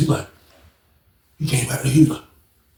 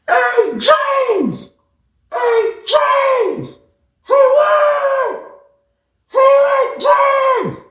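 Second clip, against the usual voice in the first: calling out from afar; much slower than usual. Retold or imitated. Yelling a name from the fourth-floor window. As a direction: holler the name up across the yard.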